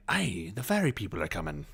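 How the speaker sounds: put-on soft Irish accent, hushed; imitating Donal in a quiet Irish accent. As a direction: murmur it in an Irish lilt like a spooky warning. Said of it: "And Donal would be like".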